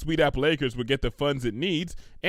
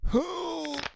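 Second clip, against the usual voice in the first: excited gasp; 4 dB quieter than usual. Acted. Pinkie gasps.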